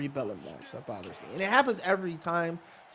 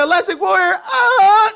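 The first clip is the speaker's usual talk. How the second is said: high loud strained cry; movie quote impression; 13 semitones higher, 13 dB louder, slower than usual. Recalling a Next Friday scene for his friend, high yelling. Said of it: "He said".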